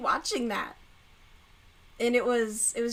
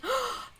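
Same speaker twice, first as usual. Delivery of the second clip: sharp gasp; 13 semitones higher than usual. Real, not acted. Remembering what she did, a sharp gasp. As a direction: gasp as it hits her. Sudden realization mid-sentence.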